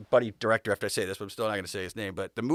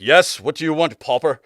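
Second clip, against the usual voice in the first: haughty royal; 3 semitones higher, 10 dB louder than usual. Retold or imitated. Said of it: "a look in him like".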